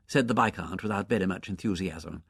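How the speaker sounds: ordinary delivery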